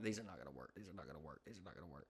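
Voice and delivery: very low, soft mumble to oneself; doubtful muttering. Retold or imitated. Buyer at the hardware store, skeptical muttering. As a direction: grumble it under your breath, dropped right down. Retells doubts while buying traps.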